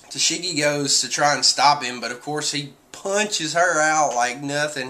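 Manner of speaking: normal talking voice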